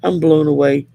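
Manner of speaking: ordinary talk, nothing marked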